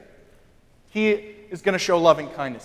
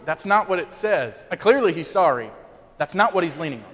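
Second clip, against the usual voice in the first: ordinary talk, nothing marked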